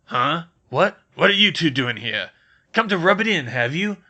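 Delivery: put-on loud, startled, suspicious old man; grumpy caretaker. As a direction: bark at the intruding students.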